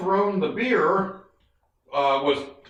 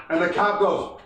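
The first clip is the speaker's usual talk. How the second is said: ordinary talk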